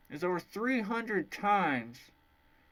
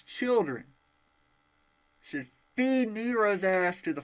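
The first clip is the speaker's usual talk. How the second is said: a loud, heated rant, rising higher as it goes; angry rant; 4 semitones higher, 3 dB louder than usual. Real, not acted. A reviewer berating the character who burned the forest, in a loud angry rant. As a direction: tear into him, more furious with every line.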